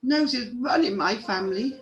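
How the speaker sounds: high, sniffly dwarf voice; snuffly little dwarf with a runny nose; sniffing. A narrator's reading. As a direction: complain between sniffs in a high voice. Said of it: "sniffed Sniffy".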